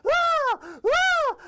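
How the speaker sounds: piercing, very high loud shriek; cartoon scream. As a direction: scream at full pitch like a terrified cartoon. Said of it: "His pupils are screaming".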